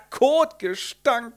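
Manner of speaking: slow, high drawn-out disgust; a character holding her nose. Acted. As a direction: stretch the word out in disgust.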